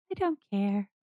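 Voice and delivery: lightly voiced small child; a hint of a sulky little kid. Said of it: "back into her little kid self".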